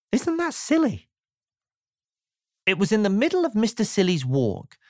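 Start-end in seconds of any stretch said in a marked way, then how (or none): 0.1-1.1 high, delighted storyteller aside; playful aside. A narrator's reading. Narrator to the children, high and delighted. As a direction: squeak it out, tickled by the silliness.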